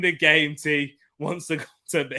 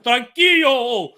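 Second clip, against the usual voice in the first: slow, drawn-out Spanish calm-down, pitched up; Narcos-style drawl; 10 semitones higher, 7 dB louder, much slower than usual. Retold or imitated. Simeone gets the slow, drawn-out Narcos treatment. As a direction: stretch each tranquilo like a cartel boss soothing someone. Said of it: "I watched enough Narcos".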